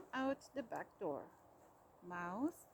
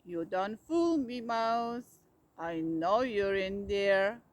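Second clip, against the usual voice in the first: louder, slow gotcha; triumphant cry; 4 semitones lower, 8 dB louder, slower than usual. A narrator's reading. Bear opening the box, loud gotcha cry. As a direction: call him out, sure you caught him. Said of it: "Bear cried".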